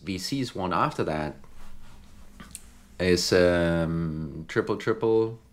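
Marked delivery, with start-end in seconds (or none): none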